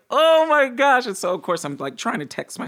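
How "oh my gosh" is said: high, loud retold gasp of amazement; amazed, high-pitched storytelling exclamation; amazed outburst. Retold amazed reaction to teleporting.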